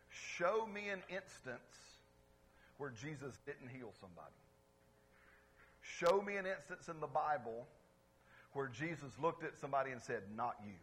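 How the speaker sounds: normal talking voice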